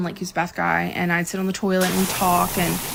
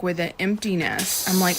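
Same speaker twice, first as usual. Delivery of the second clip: ordinary talk, nothing marked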